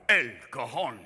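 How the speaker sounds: put-on low, rolling Spanish accent; sudden sultry switch to Spanish accent. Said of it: "all of a sudden it changes accents".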